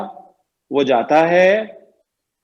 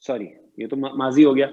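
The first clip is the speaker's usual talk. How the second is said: ordinary delivery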